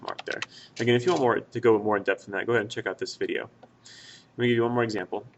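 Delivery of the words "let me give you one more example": ordinary talk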